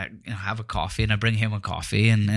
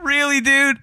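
amused disbelief; 13 semitones higher, 5 dB louder than usual. Retold or imitated. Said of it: "I'm like".

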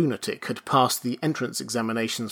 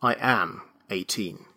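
weary; 4 semitones lower, 5 dB quieter than usual. A narrator's reading. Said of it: "smiled wearily".

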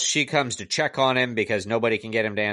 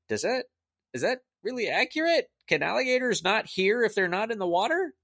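high-pitched, rising sceptical questions; incredulous questioning; 10 semitones higher than usual. Acted. Reviewer doubting alligator facts from a movie, incredulous questioning.

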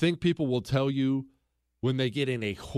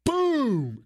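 loud, high "Boom!" like a thud; sudden mock impact boom; imitated crash; 10 semitones higher, 4 dB louder than usual. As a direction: punch out the crash sound. Dog hitting concrete, imitated crash.

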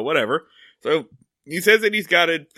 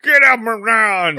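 put-on grumpy old man; 5 semitones higher, 4 dB louder, slower than usual. Grumpy old man yelling at kids.

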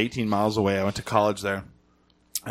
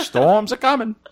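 higher, laughing Maine local; Maine accent; 10 semitones higher than usual. Pretend. Imitating a Maine local, laughing, Maine accent. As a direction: play the old Mainer feeling it in his bones. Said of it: "I'm trying to think of what it sounds like".

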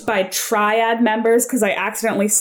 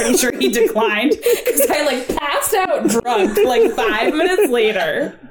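laughing; 5 semitones higher, 3 dB louder than usual. Real, not acted. Laughing through embarrassing confession.

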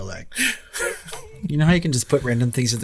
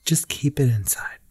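whispered. Pretend. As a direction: whisper the lyric back. Whispered sung reply.

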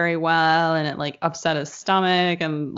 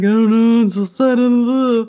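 higher, louder whiny voice of a queasy husband; husband moaning that he doesn't know what's wrong, higher and louder; upset husband; 4 semitones higher, 7 dB louder than usual. Retold or imitated. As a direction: play the sick, miserable husband, loud and high. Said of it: "He's like".

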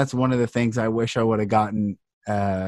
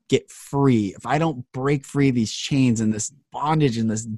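normal talking voice

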